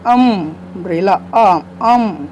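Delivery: अम्ब्रेला then a stretched अम, अम, showing the opening vowel sound; pronunciation model. Shown as a demonstration. Teacher showing students the vowel sound that starts umbrella. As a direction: slowly isolate the first syllable अम. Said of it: "लेकिन उच्चारण क्या हो रहा है".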